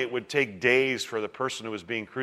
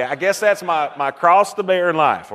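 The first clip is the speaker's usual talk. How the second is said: mock Southerner, pushed up in volume; imitated Southern accent; 5 semitones higher, 6 dB louder than usual. Preacher mimicking Southerners bearing their cross, in Southern accent. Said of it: "I've heard Southerners say it".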